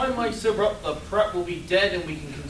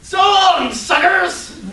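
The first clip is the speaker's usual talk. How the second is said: far higher, much louder parting yell; taunting shout; 11 semitones higher, 11 dB louder, much slower than usual. Acted. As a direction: holler it over your shoulder while racing off. Hero's sidekick runs off taunting.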